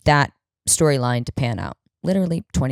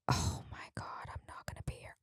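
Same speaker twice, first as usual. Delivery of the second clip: whispered in quiet panic; panicked whisper; 21 dB quieter than usual. Retold or imitated. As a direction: hiss it under your breath as the plan collapses. Panicked aside to herself.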